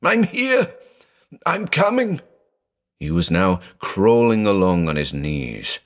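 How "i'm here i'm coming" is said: high, desperate call to his trapped sister; shrieking. A narrator's reading. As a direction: reach her with your voice. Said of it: "he shrieked".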